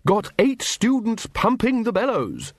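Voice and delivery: high, slow, booming boast; boastful Ridcully. A narrator's reading. Wizard shows off the organ to Vimes, boastful. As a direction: draw it out with proud relish.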